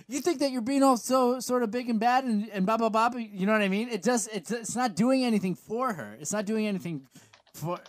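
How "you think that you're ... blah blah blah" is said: whiny promo. Retold or imitated. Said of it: "she's like".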